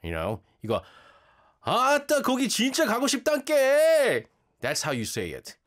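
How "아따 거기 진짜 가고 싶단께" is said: loud, high, fast Jeolla dialect; Jeolla accent. Shown as a demonstration. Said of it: "a way to say it in the Jeolla accent".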